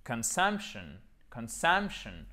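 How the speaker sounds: "consumption" with sump stressed and a weak shun; accent tonique. Shown as a demonstration. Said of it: "l'accent tonique sur la syllabe qui est juste avant". (« consumption », accent sur sump, O atténué)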